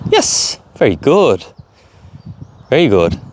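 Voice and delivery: slow, drawn-out "very good"; drawn-out praise. Real, not acted. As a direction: stretch the praise out.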